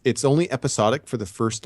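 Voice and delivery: ordinary talk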